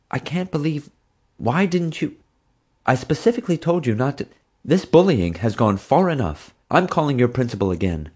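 a mother too upset to finish her sentences; worked up mother. A narrator's reading. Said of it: "Mom was so worked up".

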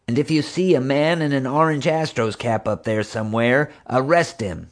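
a hint of an urgent order over the radio; Marge. A narrator's reading.

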